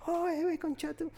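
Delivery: high-pitched, soft mock of boys trading insults; lightly ape teenage boys cursing each other, then cackle. Retelling teens at McDonald's to his friend, high soft mock insults. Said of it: "comenzaron a gritarse así".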